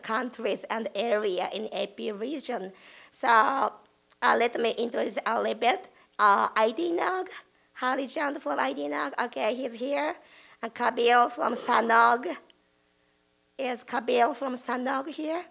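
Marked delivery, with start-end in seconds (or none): none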